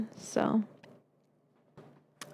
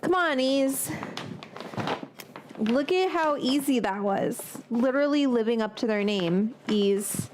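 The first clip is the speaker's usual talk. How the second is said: usual speaking voice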